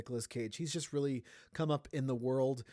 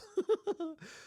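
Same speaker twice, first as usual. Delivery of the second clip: high laugh. Real, not acted. Laughs at the wine slogan.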